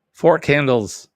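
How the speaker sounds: a touch of a comic shopkeeper; mock sketch voice.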